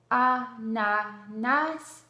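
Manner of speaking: "ananas" very slowly, split a-na-nas; syllable by syllable. Shown as a demonstration. Teacher asking a child to find A in the word, syllable by syllable. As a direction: break "ananas" into three long, separate syllables. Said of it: "décomposez bien le mot en syllabes".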